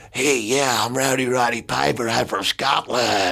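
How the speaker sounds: a Roddy Piper-style Scottish wrestler intro; Scottish accent. Retold or imitated. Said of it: "Scottish. He'd come out and go".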